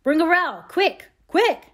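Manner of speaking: loud, high shouted command; urgent cry. A narrator's reading. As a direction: cry out for help in an emergency. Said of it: "Laurie's voice cried out".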